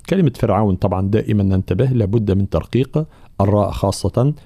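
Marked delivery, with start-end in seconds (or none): none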